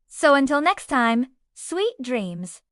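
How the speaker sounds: ordinary delivery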